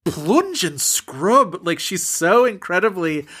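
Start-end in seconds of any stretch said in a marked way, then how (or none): 0.0-1.6 slow, disgusted drawl of plunge and scrub; imitating her repulsed mother, slowed right down; repulsed mock quote. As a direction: say it like the idea revolts you. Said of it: "repulsed by this notion".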